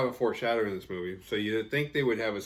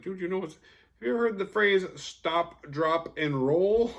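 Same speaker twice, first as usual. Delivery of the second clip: mocking; 9 semitones higher, 6 dB louder than usual. Mocking the burning sheriff.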